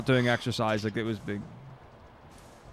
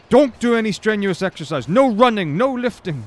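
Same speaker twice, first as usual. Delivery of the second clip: loud, high, insistent warning; adamant dentist; 7 semitones higher, 6 dB louder than usual. Retold or imitated. Dentist forbids exercise after extraction, loud and insistent. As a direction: lay down the rules like a stern dentist. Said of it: "he was very adamant about not doing".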